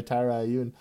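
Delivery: normal talking voice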